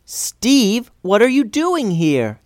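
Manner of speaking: high, happily surprised question; smiling delight. A narrator's reading. Tulsi greeting the Goblin King, high smiling delight. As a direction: ask it through a big smile. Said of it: "with a big smile".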